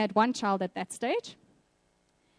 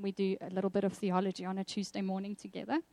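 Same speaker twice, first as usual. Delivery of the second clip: normal talking voice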